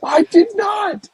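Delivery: high, slow shouted denial; indignant shout. Real, not acted. Denying accusation indignantly.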